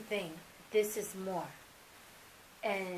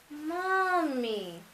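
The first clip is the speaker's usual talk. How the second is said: put-on a small boy saying his first word, drawn out and tender; sweet little voice; 5 semitones higher, 3 dB louder than usual. Toddler waking mom with his first word, sweet and higher. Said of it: "it was a sweet voice".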